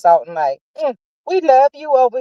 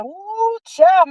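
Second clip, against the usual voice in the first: high excited ooh; 9 semitones higher than usual. Retold or imitated. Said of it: "I said".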